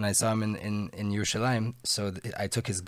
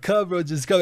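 urging call; 9 semitones higher, 7 dB louder than usual. Retold or imitated. Urging friend over to the Rav.

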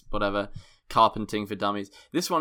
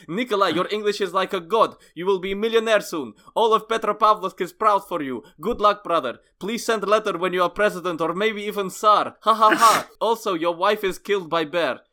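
put-on high, loud, exultant Russian accent; 7 semitones higher, 5 dB louder than usual. Brother writing back to Nikolai, exultant Russian accent. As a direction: gush praise in booming Russian English. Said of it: "He write back".

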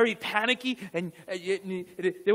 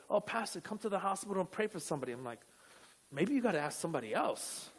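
hushed retelling; 2 semitones lower, 9 dB quieter, faster than usual. Retold or imitated. Retold reluctant exchange with a churchgoer.

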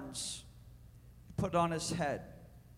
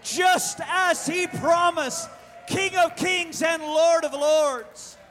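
high, drawn-out preacher's proclamation; preaching; 7 semitones higher, 4 dB louder, slower than usual. Real, not acted. Preacher proclaiming the return of Jesus Christ to the congregation, preaching.

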